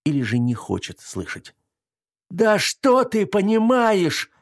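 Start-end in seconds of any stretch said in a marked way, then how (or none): 2.3-4.3 high, slow trembling cry; trembling shout. Acted. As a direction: shout it with a shaking voice. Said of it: "прокричала Женя дрожащим голосом".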